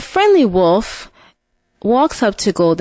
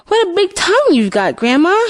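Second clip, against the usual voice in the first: high, quick exclamation of wonder; raised-pitch childlike astonishment; storybook amazement; 7 semitones higher, faster than usual. Acted. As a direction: marvel at it in a high voice.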